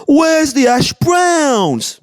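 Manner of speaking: high-pitched, drawn-out English whine; mock English accent. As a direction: shout it slowly like an outraged English caricature. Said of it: "if you're English and you're watching".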